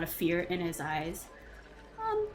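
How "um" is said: put-on frightened boy.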